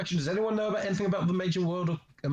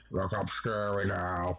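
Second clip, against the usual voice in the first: low, slow spooky voice; scary Halloween voice; 9 semitones lower, slower than usual. Pretend. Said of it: "time for me to do my Halloween voice".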